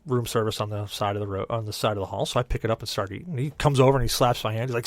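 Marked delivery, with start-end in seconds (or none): none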